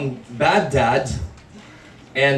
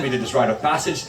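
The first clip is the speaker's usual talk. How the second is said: ordinary talk, nothing marked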